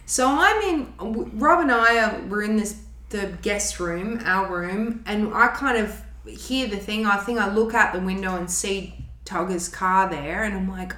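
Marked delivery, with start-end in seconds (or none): none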